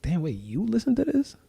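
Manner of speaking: high, soft disbelief; mock amazed that anyone listened; a soft incredulous aside; surprised.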